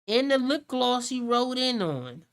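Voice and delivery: mock preacher rebuke. Mother rebuking her son's girlfriend like a preacher. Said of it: "I be like".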